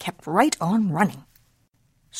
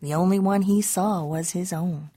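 plain reading voice

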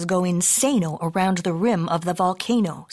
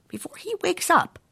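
high urgent warning; ordering mama; 10 semitones higher than usual. A narrator's reading. Mama warning about the sleeping dog, ordering. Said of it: "ordered Mama".

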